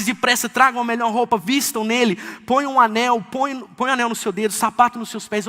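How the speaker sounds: father shouting to his servants to bring the calf, jubilant and loud; joyful father calling out. Acted. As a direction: call out the feast like an overjoyed father. Father orders robe and ring.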